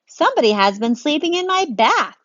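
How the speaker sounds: a dad finding a bear in his bath; loud, outraged father; indignant daddy. Acted. Daddy voiced in story.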